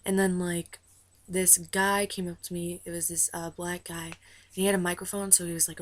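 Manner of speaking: ordinary delivery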